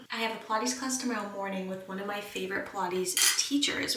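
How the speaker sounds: normal talking voice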